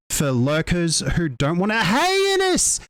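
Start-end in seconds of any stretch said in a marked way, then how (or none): 1.8-2.8 sudden high-pitched, drawn-out hey; excited greeting. Real, not acted. Streamer spotting a viewer dropping by, excited greeting. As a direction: burst into a high hello mid-thought.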